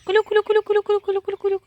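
high sing-song calling to poultry; high repeated call to the turkeys. Real, not acted. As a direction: call the birds in with a high chant.

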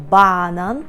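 "банан" stressed on the first syllable, БА-нан, stretched. Shown as a demonstration. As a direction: hit and lengthen the first "ба" in "банан". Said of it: "зверніть увагу на наголос".

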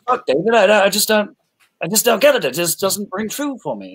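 imitating an actor pushing back on his director; frustrated actor. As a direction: Oldman arguing a scene with Fincher, hammed-up frustration. Retold Oldman arguing with Fincher.

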